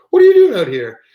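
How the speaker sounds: high-pitched, loud mock astonishment; startled surprise. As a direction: imitate a woman's astonished question, high and loud. Ex-girlfriend's startled question, retold.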